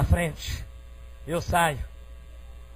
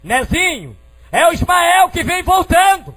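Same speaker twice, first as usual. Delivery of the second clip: high, loud call across the yard; mother's distant yell; 12 semitones higher, 9 dB louder than usual. Retold or imitated. Mother calling out that her son returns, retold as a high yell. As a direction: yell the news from far off. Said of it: "a minha mãe gritou de lá".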